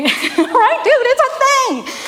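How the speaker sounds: laughing, high-pitched amused exclamation; real laughing. Real, not acted. Speaker reacting to a dude in the audience, real laughing. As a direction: break into a laugh and carry on high. Laughing aside to someone present.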